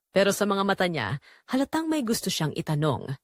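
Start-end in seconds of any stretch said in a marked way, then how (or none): none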